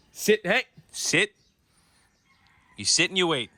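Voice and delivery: stern repeated sit commands; firm dog command. Real, not acted. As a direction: make the dog obey.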